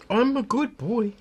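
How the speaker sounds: high, slow, cutesy put-on voice; playful good-boy voice. Helper answering praise for bringing backup, high playful little-boy voice.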